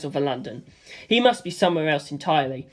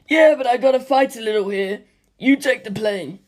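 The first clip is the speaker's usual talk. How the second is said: Stephen's voice; 5 semitones higher than usual. Acted.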